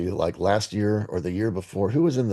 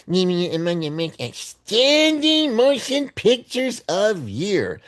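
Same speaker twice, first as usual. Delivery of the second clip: very high, exaggerated citation voice; mocking read-aloud; 10 semitones higher, slower than usual. Reading the award citation to listeners, mocking very high voice. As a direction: push the silly reading voice way up high.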